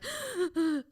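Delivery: sharp high gasp of alarm; panicked gasp. Shown as a demonstration. Showing the host her panic, with a sudden gasp. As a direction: suck in air like you've been grabbed. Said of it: "I literally would go into full body".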